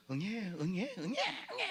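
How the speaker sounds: high mock baby wail, rising; hammed-up baby crying; imitated baby cry. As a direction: wail like an infant.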